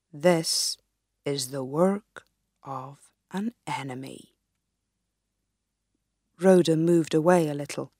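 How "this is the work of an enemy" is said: put-on low, hushed old man's voice; wise old sage. Wise man names an enemy.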